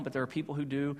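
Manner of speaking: ordinary talk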